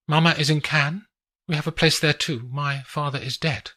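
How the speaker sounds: woman showing off the view; Adelina's voice. Acted.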